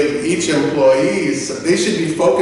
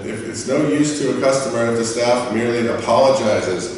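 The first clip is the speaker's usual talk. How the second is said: ordinary delivery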